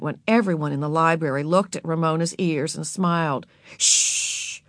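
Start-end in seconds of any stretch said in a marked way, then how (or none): 3.8-4.6 whispered shush. A narrator's reading. Beezus hushing Ramona in the library, whispered. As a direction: hush her with a whisper. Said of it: "she whispered".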